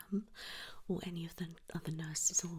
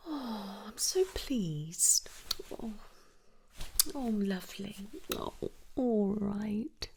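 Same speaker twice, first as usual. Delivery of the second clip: long, stretched-out cooing; touched, affectionate congratulations; tender delight; 4 dB louder, slower than usual. Acted. Nurse pleased for emotional patient.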